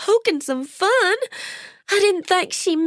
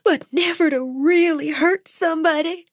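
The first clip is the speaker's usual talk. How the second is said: high, tearful and worn out; weeping; 12 semitones higher than usual. A narrator's reading. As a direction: cry it out, exhausted. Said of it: "began to weep".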